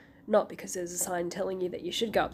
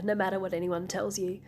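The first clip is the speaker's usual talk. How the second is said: ordinary talk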